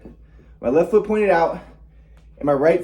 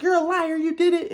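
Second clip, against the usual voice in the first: high-pitched mock mom accusation; retold screaming. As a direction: imitate a mother screaming, without full volume. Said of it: "she was screaming at her".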